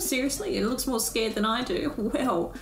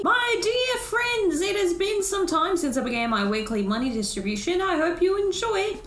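grand robot benefactor; 7 semitones higher than usual. Acted. Robot addressing villagers at money distribution, grandiose. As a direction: play a pompous host greeting a crowd.

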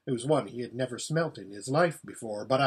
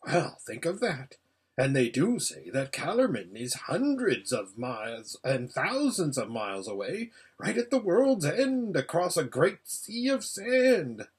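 marvelling hedgehog. Acted. Hedgehog talking of far Calormen, marvelling. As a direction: marvel like a rustic little hedgehog.

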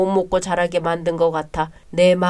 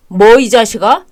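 angry shout; 2 semitones higher, 10 dB louder than usual. Acted. Brother explodes again.

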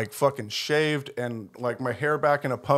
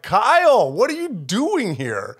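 loud, high-pitched greeting, drawn out; retold booming hello to Kyle; blowing his cover; 7 semitones higher, 4 dB louder, slower than usual. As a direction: call out a friend in astonishment, stretching the words. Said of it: "he blows up my spot".

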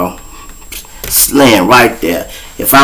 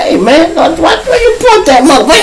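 laughing alarm. Retold or imitated. Pleading with cop pointing gun.

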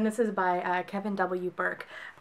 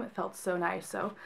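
ordinary talk, nothing marked

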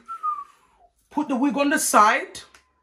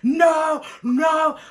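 high, loud drawn-out refusal; mock wail of protest; protesting cry; 5 semitones higher, 5 dB louder than usual. Reacting to the call to fight outside, loud drawn-out protest.

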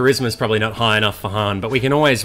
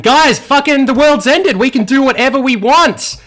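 excited; 13 semitones higher, 6 dB louder than usual. Real, not acted. End of world, gleeful celebration.

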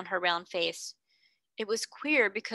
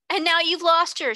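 higher, louder wild cry; 8 semitones higher, 7 dB louder than usual. A narrator's reading. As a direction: fling the words out wildly. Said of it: "she cried wildly".

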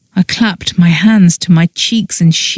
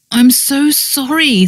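contrite; 7 semitones higher than usual. A narrator's reading. Friend squeezing hands of mortified woman, contrite. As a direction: apologise gently, drawing it out. Repeated apology, milder than wail.

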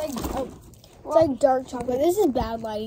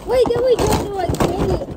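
loud protest; 9 semitones higher, 8 dB louder than usual. Real, not acted. Protesting a change of plan.

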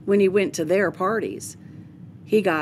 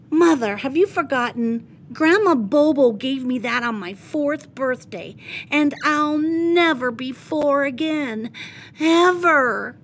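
hammed-up, higher, louder child lament; milk the tragedy like a little actor; dramatic; 7 semitones higher, 3 dB louder than usual. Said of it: "Don't be so dramatic".

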